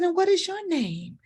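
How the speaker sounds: put-on high, sweet voice for a small child; kindly grown-up to a little one; talking to child.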